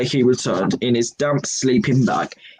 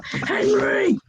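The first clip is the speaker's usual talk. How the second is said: high shouted name; yelled. Acted. Dad yelling at Henry over the music. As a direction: call him out sharply. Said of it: "yelled Dad".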